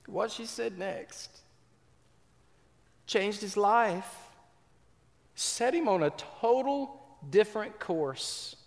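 slow, high-pitched suspenseful telling; preacher slowing down before the turning point; dramatic build. Acted. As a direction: draw out each word to build suspense.

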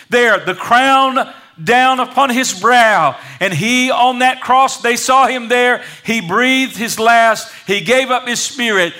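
impassioned preaching. Real, not acted. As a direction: draw out each phrase with mounting sermon fervor. Preaches the crucifixion at raised intensity.

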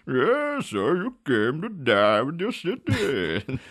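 slow, muffled menacing villain; Bane impression. Podcaster doing Bane in a recap bit, slow and menacing. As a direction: drag it out like a masked villain. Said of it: "do an impression".

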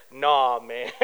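very low, laid-back refusal; voice of God. Retold or imitated. The Lord refusing the preacher's request, deep and casual. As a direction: drop deep for a casual divine no. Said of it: "when you ask the Lord that".

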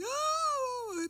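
high wailing sob "Oh!"; imitated sobbing cry. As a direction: wail it like a girl breaking down. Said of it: "like, sobbing".

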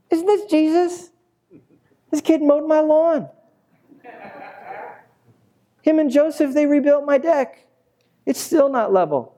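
high-pitched incredulous local; high, comically indignant townsperson; grumbling neighbor. Acted. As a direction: squawk it like a skeptical neighbor. Acted grumbling crowd of neighbors.

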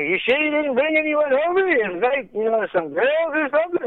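high, suspicious questioning voice; lightly voiced Norm, quizzing; Norm Macdonald voice.

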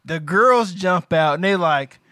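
ordinary talk, nothing marked

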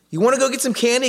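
high, loud, hyped-up offer of candy; excited dad. Retold or imitated. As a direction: pump the kid up with a big excited pitch. Dad hyping up his disappointed son.

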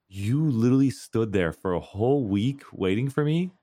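high, disbelieving "you are sick", drawn out slow; incredulous mock scolding. Gamer mocking the player who camped a week, incredulous.

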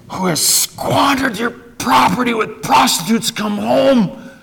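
put-on older son's bitter complaint, slow and rising high; angry older son. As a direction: pour out years of hurt resentment, near tears.

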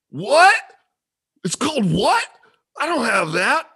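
high, loud, incredulous mock Scot; hammed-up Scottish disbelief; bad Scottish accent. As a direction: yelp it as a baffled Scotsman. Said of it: "my Scottish accent".

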